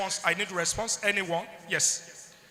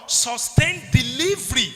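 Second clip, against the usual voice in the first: "sustain delivery" slow, loud and raised; emphatic stress; 4 semitones higher, 6 dB louder than usual. Shown as a demonstration. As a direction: hammer home "sustain delivery", word by word. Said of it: "underline the word".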